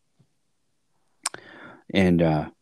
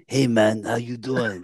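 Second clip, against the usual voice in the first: lightly voiced laid-back stoner greeting; a hint of a hammed-up Cheech Marin "hey man"; mock-casual dude voice, slightly higher; Cheech-style impression; 5 semitones higher than usual. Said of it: "You didn't go into Cheech mode?".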